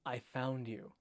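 low, subdued read with a hint of sulk; sulky edge. A narrator's reading. Reading Wormtail's line to co-host, sulky. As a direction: read it softly, lightly sulky. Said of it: "definitely a sulky edge to his voice".

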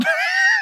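very high burst of laughter; shrieking laugh. Real, not acted. Finishing his own story about the drunk voiceover, shrieking laugh.

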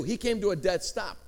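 ordinary talk

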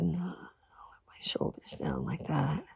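slow whisper, almost no voice; soft slow whisper. Retold or imitated. Woman whispering how he laid her down gently. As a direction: let it fade to a hush. Tender moment in bed.

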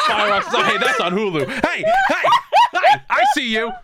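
very high, laughing call-out; high excited calling. Pretend. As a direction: squeal it out through laughter. Calling out at image.

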